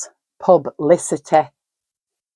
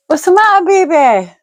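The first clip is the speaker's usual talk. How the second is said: much higher, louder cooing to a pet; pet talk to the dog; 11 semitones higher, 4 dB louder than usual. Real, not acted. Talking to her dog.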